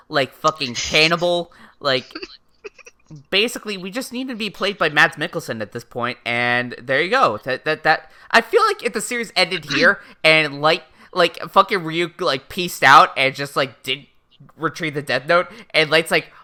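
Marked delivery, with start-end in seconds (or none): none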